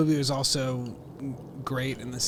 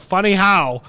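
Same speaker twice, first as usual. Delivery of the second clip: higher, louder mock challenge; quoting the line; 7 semitones higher, 12 dB louder, slower than usual. Joking back at a friend, mock menacing. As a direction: snap it back as a joke. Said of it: "You go".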